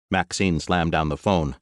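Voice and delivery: ordinary delivery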